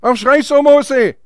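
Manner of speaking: high, loud challenge; forceful divine rebuke. Acted. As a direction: call the question out at Moses. God's question, repeated forcefully. (laut und erhoben zugerufen)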